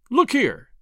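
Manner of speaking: high, emphatic "look here" of rebuke; stern. A narrator's reading. Lodge member cutting short the older man's complaint, stern. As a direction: pull him up sternly. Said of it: "said McMurdo sternly".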